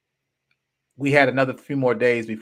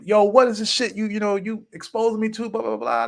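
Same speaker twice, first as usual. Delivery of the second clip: high-pitched retold rant; mock tirade running into blahs; going off on him; 9 semitones higher than usual. Storyteller confronting his friend downstairs, going off on him. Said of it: "ready to go off on him".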